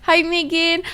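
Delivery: high bubbly greeting; cute anime streamer. Real, not acted. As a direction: squeal hello to the viewers. Greeting raid viewers excitedly.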